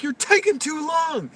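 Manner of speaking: high, loud impatient shout; angry yell. Retold or imitated. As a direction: yell at the truck driver to hurry. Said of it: "He's like".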